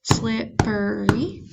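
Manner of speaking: Slippery said slowly, SLIP-PER-Y; slow teacher count of Slippery; syllables tapped out. Shown as a demonstration. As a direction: give Slippery one beat per syllable. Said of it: "saying these and clapping on your table".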